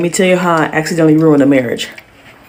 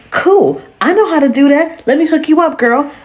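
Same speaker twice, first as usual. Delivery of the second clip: much higher, bright customer-service chirp; perky clerk voice; 8 semitones higher than usual. Retold or imitated. Cheerful retail clerk voice.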